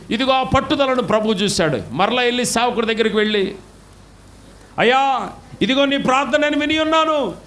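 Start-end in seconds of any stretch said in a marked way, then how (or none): 4.8-7.3 exalted proclamation. Acted. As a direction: proclaim the promise with rising fervour. Preacher voices God's answer.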